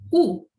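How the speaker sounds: "U" as the sound of a final O. Shown as a demonstration. Teacher showing the third Portuguese variation, U sound. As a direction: give the final-O variant as a pure "U". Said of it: "quando está no final de uma palavra".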